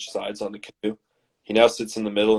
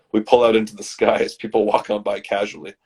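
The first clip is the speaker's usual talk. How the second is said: ordinary talk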